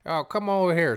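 high-pitched mock call across a shop; retold holler with an attempted New York accent; calling someone over. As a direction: imitate a boss summoning his worker. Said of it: "he calls this guy".

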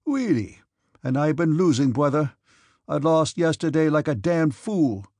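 loud cry with R's softened to W's; dropped R's. A narrator's reading. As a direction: exclaim it without pronouncing the R's. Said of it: "cried Denisov, not pronouncing his R's".

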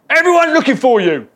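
high, very loud barging-in yell; exclaimed shout. Acted. Disciples barge in on Jesus's holy moment, exclaimed shout. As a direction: shout it at him as you burst in. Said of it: "they exclaim".

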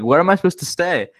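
mocking the teacher. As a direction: imitate a student sassing the teacher. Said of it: "she was, like, mocking the teacher".

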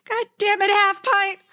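high, half-laughing wail of a choked-up husband; husband's mock wail. Wife imitates her husband crying at the movie's end, high and laughing. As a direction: whine it like a grown man caught crying at a movie. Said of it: "And my husband goes".

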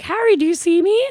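high excited call to the stage; shouting to a star. Retold or imitated. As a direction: call out like a fan at a concert. Retold concert shouting to [name].

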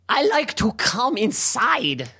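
high, slow mock German accent. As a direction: draw it out in a comic German voice. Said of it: "can you do a German accent?".

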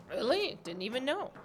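retold surprise, pitch jumping up; shocked. Guest retelling the news of his move, shocked. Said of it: "I was shocked".

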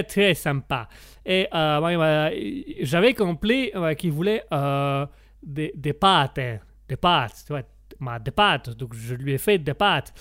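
halting storyteller in a mock Italian accent; Italian accent, feeling for each word. Italian guest explains a misunderstanding, sheepish Italian accent. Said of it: "comme on l'entend à mon accent".

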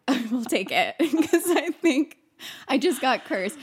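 laughing. Real, not acted. Laughing at own story.

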